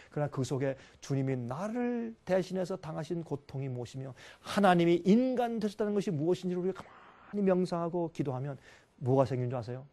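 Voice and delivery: ordinary talk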